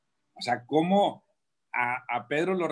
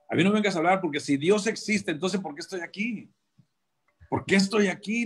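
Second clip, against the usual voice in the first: higher, rising mock outburst of prison rage; retold anger; 6 semitones higher than usual. Inmate snaps back at the preacher, retold angry outburst. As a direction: relive the angry retort, pushing it up. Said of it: "me dio un coraje".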